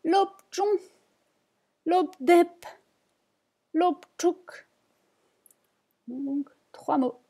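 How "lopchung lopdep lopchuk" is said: "Lopchung", "lopdep", "lopchuk" said high and distinct, one by one; Tibetan words. Shown as a demonstration. Teacher repeats the three words for learners, model pronunciation. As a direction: model each word for the class. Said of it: "les trois mots, une nouvelle fois".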